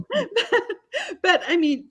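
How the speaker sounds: real laugh breaking through high. Real, not acted. Amused by the Irish story, laughing while talking.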